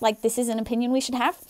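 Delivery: ordinary delivery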